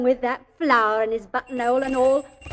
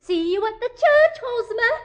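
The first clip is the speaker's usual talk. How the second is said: much higher call after him; 11 semitones higher than usual. Acted. Mother calls to Hosmer from the cab, high call. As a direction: call back from the moving cab.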